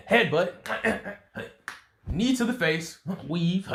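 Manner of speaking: high, punchy combat calls; acted fight moves. Storyteller acts out a brawler duking it out, punchy excitement. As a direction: call each blow as it lands.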